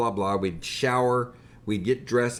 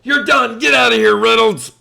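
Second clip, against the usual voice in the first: much louder, higher bellowed dismissal; drill sergeant yell; 10 semitones higher, 14 dB louder than usual. Retold or imitated. Drill instructor dismissing exhausted recruit, loud yell. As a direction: yell it out the office door. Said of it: "he goes, he goes".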